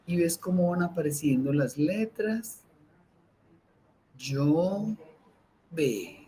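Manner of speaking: slow, soft meditation voice with long pauses; guided meditation. Acted. Teacher guiding listeners through breathing, calm meditation voice. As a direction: breathe the words out, calm and unhurried.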